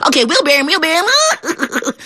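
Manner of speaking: high, giggly frog agreeing; laughing frog. Acted. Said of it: "The frog said".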